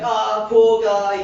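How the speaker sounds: high, loud, slow mock sympathy; a hammed-up God pitying the drowning man again; God's pity. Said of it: "God's like".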